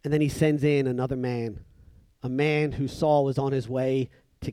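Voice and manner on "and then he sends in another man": normal talking voice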